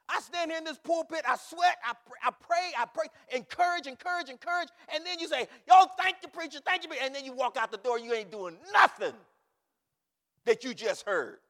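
high, loud, sustained pulpit shout; full-force preaching. Real, not acted. Preacher scolding those who walk out unchanged, full-force preaching. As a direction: holler the rebuke at the congregation.

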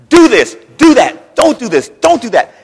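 loud clipped orders; drill sergeant barking. Retold or imitated. Drill sergeant yelling at recruits, loud orders. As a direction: bark orders like a drill instructor. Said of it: "people are hollering at you".

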